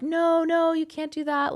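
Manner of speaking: high-pitched pleading objection; put-on high voice, calling to stop someone; imitated shaman. Shamans stopping her driving away.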